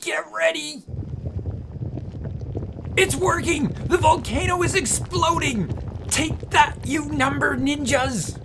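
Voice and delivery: high, thrilled cheering; excited shout. Real, not acted. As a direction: cheer the trap going off. Volcano erupts on ninjas.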